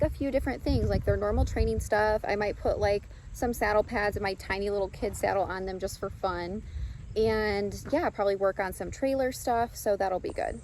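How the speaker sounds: ordinary talk, nothing marked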